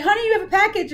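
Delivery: playfully hyped announcement to the friend; singsong announcement. Retold or imitated. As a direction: sing it out like it's big news to a little kid. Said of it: "I was like".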